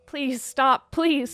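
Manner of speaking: desperate pleading scream; screaming plea. Acted. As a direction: beg at full voice, breaking. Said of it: "Someone is screaming, pleading".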